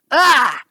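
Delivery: sharp loud cry of pain; stabbed scream. A narrator's reading. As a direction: yell as the blade hits. Stabbed through the chest.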